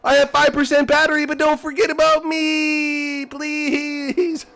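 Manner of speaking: high, loud, whiny plea from a dying car; pleading little voice. Pretend. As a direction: beg not to be forgotten, squeaky and strained. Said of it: "he's like".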